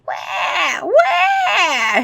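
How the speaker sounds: high wail building to a shout; hammed-up anguished cry; frantic vampire. A narrator's reading. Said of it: "he asked himself".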